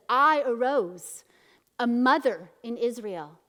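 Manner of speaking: loud, slow declamation; proclaiming. Retold or imitated. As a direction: proclaim it like a victory song. Proclaims the song of Deborah.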